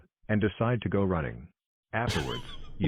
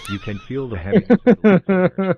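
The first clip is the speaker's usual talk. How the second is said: laughing; 12 semitones higher, 12 dB louder than usual. Real, not acted. Laughing as he reads.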